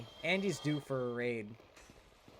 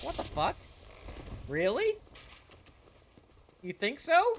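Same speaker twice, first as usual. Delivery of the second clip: high, louder disbelieving squeal; mock flattered surprise; 11 semitones higher, 4 dB louder than usual. Streamer reacting to a compliment, high and loud. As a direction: act shocked and flattered. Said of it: "I was like".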